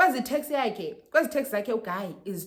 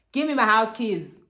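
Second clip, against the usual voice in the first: imitated loud, insistent order; loud demand; 3 semitones higher, 9 dB louder than usual. Boyfriend leaving demands his keys, loud demand. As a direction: demand it loudly, as in a retold row. Retold demand repeated louder for effect.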